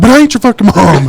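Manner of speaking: fed-up parent shouting; exasperated yell. Retold or imitated. Retold retort to his whining kid.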